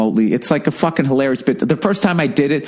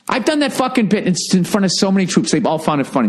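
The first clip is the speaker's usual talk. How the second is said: usual speaking voice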